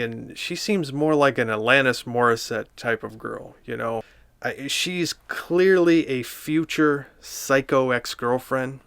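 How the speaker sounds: ordinary talk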